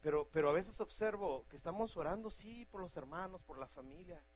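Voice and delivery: ordinary delivery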